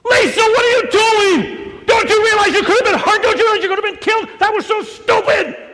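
high, loud frightened scolding; yelling at sister. Retold or imitated. Big brother yelling at his little sister, high loud scolding. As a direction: shout the fear out at her. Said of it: "Why did I yell at her".

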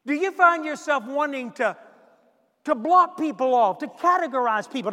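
loud, driving pulpit delivery; fiery preaching. Acted. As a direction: hammer the questions home to the congregation. Preaching at full force.

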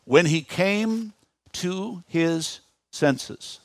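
very slow, word by word; measured reading with long pauses between words; slow, deliberate emphasis. Real, not acted. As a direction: space out each word so listeners can underline it. Phrase the listeners are to underline.

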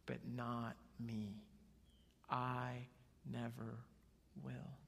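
slow, low-key solemn vow; proud Peter. Acted. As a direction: set each word apart with firm self-assurance. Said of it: "Peter being who he is and stepping up".

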